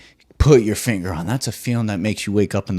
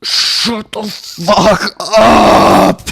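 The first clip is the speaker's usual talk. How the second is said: higher, louder, very slow yell of "Shut the fuck up"; drawn-out yell; 10 semitones higher, 8 dB louder, much slower than usual. Retold or imitated. Yelling at the contestant on TV, drawn-out exasperated yell. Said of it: "And I'm like".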